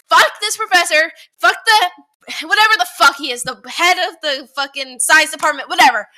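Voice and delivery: loud cursing tirade; angry rant. Real, not acted. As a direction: spit out the curses. Ranting about professor.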